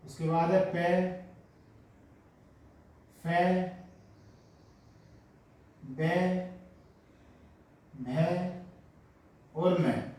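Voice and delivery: टे, ठे, डे, ढे, अण spaced out; slow dictation. Shown as a demonstration. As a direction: dictate the row slowly. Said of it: "तो पहले ये लिख लेते हैं".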